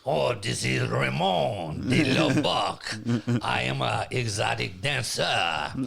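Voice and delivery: slow, low, put-on French accent. Slow French accent introducing himself to the chat room. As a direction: purr it like a French male stripper. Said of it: "I talk with an accent".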